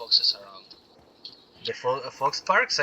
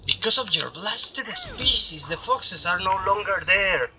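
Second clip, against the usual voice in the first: lightly hammed-up boss speech; villain lines read aloud; 2 semitones higher than usual. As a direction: read the enemy's monologue with mock drama. Reads game character's lines.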